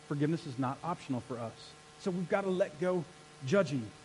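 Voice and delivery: ordinary delivery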